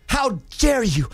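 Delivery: slow, hammed-up indignant girlfriend; mock outrage. Girlfriend confronting the side chick, slow mock outrage. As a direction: drag out a fake-offended how dare you. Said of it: "girlfriend slapping, Ah".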